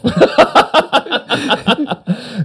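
high, sustained burst of laughter; hearty laugh. Real, not acted. Joke about writing it off.

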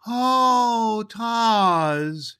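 put-on high, loud child's gasp of delight; a little girl thrilled by the doll; high, loud rapturous oh; delighted cry. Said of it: "she cried".

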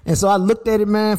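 ordinary delivery

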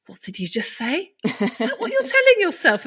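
quizzical therapist voice, rising high to a laugh; imitating the therapist. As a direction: echo her words back as a gently probing therapist. Therapist quoted, challenging her thoughts.

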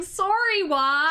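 drawn-out Canadian sorry; Canadian accent. Retold or imitated. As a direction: say it like a polite Canadian. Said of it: "it's like a Canadian being".

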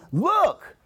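high, eager exclamation; excited. Acted. God excited about his giving, excited call. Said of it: "he's excited about this".

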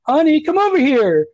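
high-pitched, outraged mock King calling his wife; indignant Stephen King. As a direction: squeak it out like a man insulted by a cash machine. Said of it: "And he's like".

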